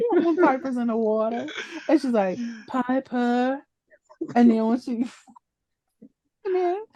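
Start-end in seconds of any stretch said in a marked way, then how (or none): 2.7-3.6 imitating Patty calling. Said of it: "neither one of them say the daughter's name".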